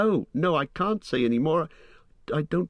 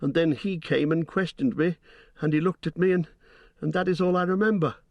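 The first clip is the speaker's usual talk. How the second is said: high, halting, rushing faster at the end; frightened stammer; 6 semitones higher than usual. A narrator's reading. Hobbit confessing to Gandalf, stammering in fear. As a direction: stumble over the words in fright. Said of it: "stammered Pippin".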